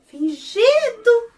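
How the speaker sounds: high, loud, drawn-out accusation; playful scolding, high and loud; mock indignation. Real, not acted. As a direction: call out the faker, stretching the word. Storyteller calls out the faker.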